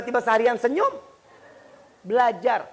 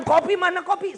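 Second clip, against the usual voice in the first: higher, louder imitation of a shouting husband; mock shouting; 9 semitones higher, 5 dB louder than usual. Husband shouting for his morning coffee, mock shouting. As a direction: mimic him yelling for coffee. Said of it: "dia teriak-teriakan".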